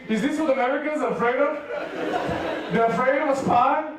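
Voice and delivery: ordinary delivery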